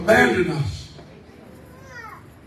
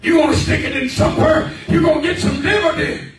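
shouted preaching, pitch up, much louder; preaching at full force; 7 semitones higher, 8 dB louder than usual. Acted. Preacher shouting to his congregation, fiery preaching. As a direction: drive the point home to the congregation.

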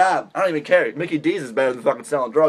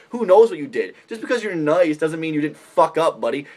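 usual speaking voice

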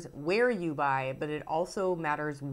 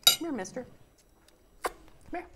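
calling a dog; 8 semitones higher, faster than usual. Real, not acted.

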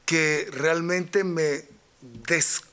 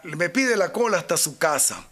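normal talking voice

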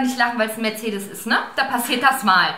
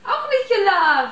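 stifled giggles; 5 semitones higher, slower than usual. Real, not acted. Mock triumph, laughing.

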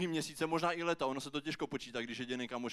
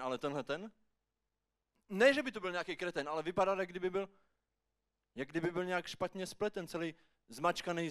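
ordinary talk

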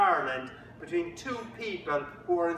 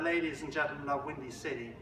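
ordinary talk

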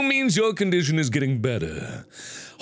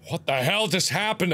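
usual speaking voice

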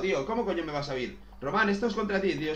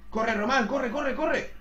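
urging hurry; 7 semitones higher, 3 dB louder, much slower than usual. Real, not acted.

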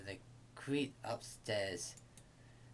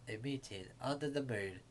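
usual speaking voice

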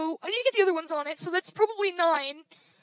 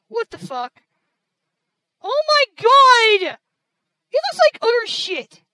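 loud, high cry of exasperation; exasperated outburst, opening drawn out slowly; real exasperation; 3 semitones higher, 8 dB louder, slower than usual. Real, not acted. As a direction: wail it out in angry disbelief. Recorded footage turns out ruined.

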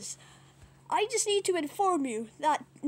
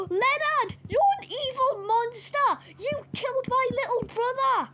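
higher, tearful accusation; mild acted wail; 6 semitones higher than usual. Pig accuses Leonard over little brother, mock tearful and high. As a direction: cry it out, lightly hammed-up.

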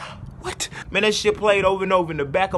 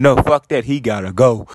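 low and loud, dead set; deep angry voice; 8 semitones lower, 5 dB louder than usual. Acted. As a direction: bark it like the angry side. Inner voice arguing.

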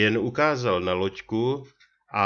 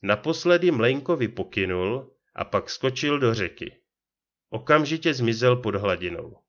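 ordinary delivery

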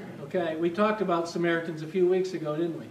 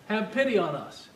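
ordinary talk, nothing marked

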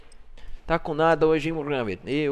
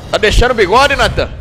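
higher, louder teasing question; teasing call-out; 7 semitones higher, 9 dB louder than usual. Real, not acted. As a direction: rib Natan loudly, high. Provoca Natan pelo bigode.

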